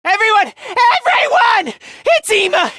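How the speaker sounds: high, urgent call to the group. Acted. Alerting everyone that it is Ima, urgent call.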